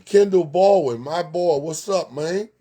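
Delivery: high, loud, happy call to a friend; excited shout-out. Real, not acted. Host greeting a viewer by name, loud and excited.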